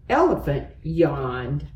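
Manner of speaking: ordinary delivery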